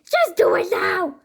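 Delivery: high, urgent storybook yell; urgent scream. A narrator's reading. Fluffy hurrying Cheeseball to fold the plane, urgent scream. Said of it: "screamed Fluffy".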